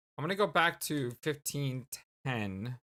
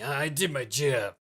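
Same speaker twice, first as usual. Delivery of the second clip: character voice. Acted. Character voice, timbre shifts.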